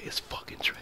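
hushed, voiceless whisper; very soft secretive whisper. Shown as a demonstration. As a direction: whisper it very quietly, as if not to be heard. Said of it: "All right, can you whisper it?".

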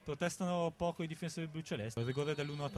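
fast Italian football commentary; live play-by-play. Real, not acted. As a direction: call the match live.

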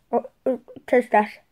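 biting candy while talking; speaking through clenched teeth; gummy worm in mouth. Real, not acted.

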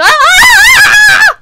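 words break into a long, high scream; real shriek of fright, very loud; startled scream. Real, not acted. Moth flies at the player's face, startled scream. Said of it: "I'm sorry for screaming just then".

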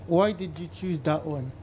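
normal talking voice

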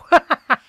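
short, high, loud burst of laughter. Real, not acted. Presenter reacting to a viewer's music suggestion, loud laugh.

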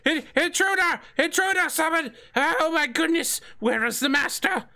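put-on high, frantic old man crying for help; panicked old assistant. Caught intruder, the old assistant panics and calls for help. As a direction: squeal it out like a terrified old servant. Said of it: "And he's just like".

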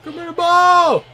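high, loud yell; urgent call. Real, not acted. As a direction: holler for the ball. Calling for the ball.

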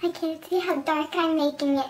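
ordinary talk

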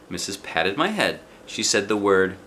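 plain reading voice

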